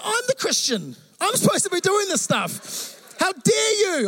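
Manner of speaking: high, hammed-up indignation; mock offended. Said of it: "I was like".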